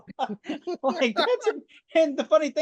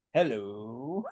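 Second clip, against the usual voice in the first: very low, drawn-out suggestive "hello"; Sulu's suggestive hello; 7 semitones lower than usual. Retold or imitated. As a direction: purr the greeting low and slow. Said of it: "Mister Sulu walked by and goes".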